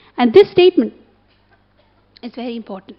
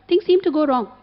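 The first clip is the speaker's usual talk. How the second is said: normal talking voice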